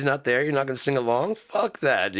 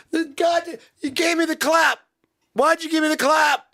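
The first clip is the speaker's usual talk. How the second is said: high-pitched outraged yell; hammed-up fury at full pitch; angry shouting; 5 dB louder than usual. Ranter blaming someone for the clap, mock furious. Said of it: "I'm angry".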